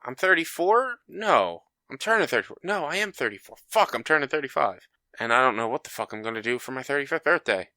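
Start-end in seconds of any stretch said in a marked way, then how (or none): 0.0-4.9 confused self quote. Retold or imitated. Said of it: "I'm like".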